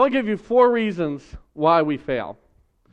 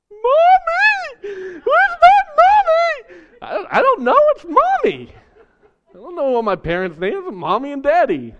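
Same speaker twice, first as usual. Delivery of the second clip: put-on crying child; 7 dB louder than usual. Lost boy crying to the clerk about his mommy. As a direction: sob it out like a lost five-year-old. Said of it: "like, crying".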